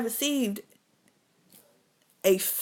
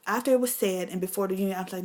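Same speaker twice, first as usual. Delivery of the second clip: ordinary talk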